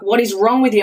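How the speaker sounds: exasperated mock shout; retold shout. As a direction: re-enact shouting at a small child. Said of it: "shouted at my three-year-old".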